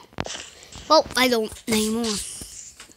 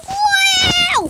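high, loud astonished shout; amazed shout; 8 dB louder than usual. Real, not acted. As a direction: yell out in amazement. Amazed at pile of wood.

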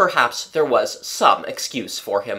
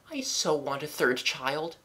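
mother's soft voice; 2 semitones higher, 7 dB quieter than usual. A narrator's reading. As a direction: answer the little boy tenderly.

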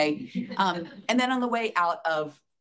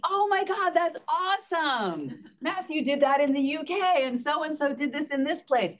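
excited gushing boss; 9 semitones higher than usual. Retold or imitated. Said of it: "He's like".

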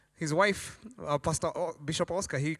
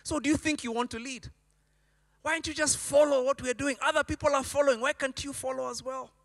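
frustrated pastor; 7 semitones higher than usual. Retold or imitated. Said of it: "I think he would think".

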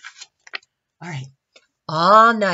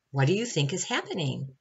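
ordinary delivery, nothing marked

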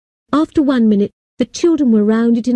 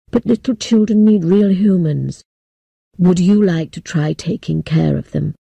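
put-on old man voice; 5 semitones lower than usual. A narrator's reading. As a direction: speak as a solemn old man. Old man explains to girl.

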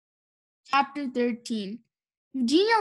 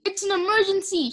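higher, urgent narrated shout; mild shout; 7 semitones higher than usual. A narrator's reading. Mister Watson reporting an emergency, mildly shouted. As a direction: call it out as an emergency. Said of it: "shouted Mister Watson".

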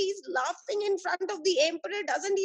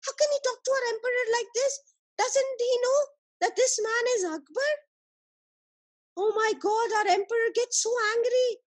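higher, rapid alarmed outburst; scandalised disbelief, voice pitched up; shocked; 6 semitones higher than usual. Acted. Soldiers aghast at how the man talks to their emperor, shocked. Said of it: "The soldiers got shocked".

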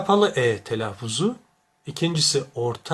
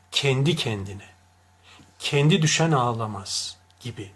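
ordinary delivery

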